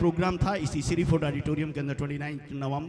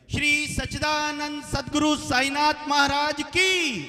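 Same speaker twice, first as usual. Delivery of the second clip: slow, much higher and louder drawn-out chant-shout; huge climactic jaikara, falling on की; full-force devotional call; 11 semitones higher, 5 dB louder, slower than usual. Real, not acted. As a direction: stretch every word and hold की at the top of the voice. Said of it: "एक साथ मिलकर जयकारे की".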